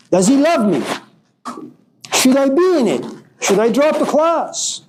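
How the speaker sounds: high-pitched, fretful questioning; acted self-doubt. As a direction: voice the anxious doubts of an unsure person. Acting out painful self-doubt.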